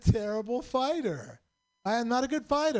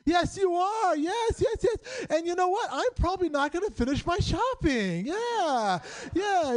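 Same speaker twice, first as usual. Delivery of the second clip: high-pitched, over-eager improv agreement; hyper-agreeing; 8 semitones higher, slower than usual. Retold or imitated. Improv student yes-anding his attacker, gushing agreement. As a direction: agree wildly with every threat. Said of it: "yes-anding and hyper-agreeing".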